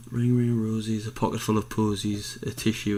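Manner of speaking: ordinary talk, nothing marked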